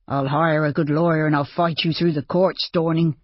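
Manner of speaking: usual speaking voice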